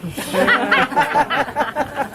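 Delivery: high, hearty chuckle after the joke; a merry laugh that rises; laughing at his own joke; jolly laugh. Real, not acted.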